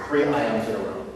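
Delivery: ordinary talk, nothing marked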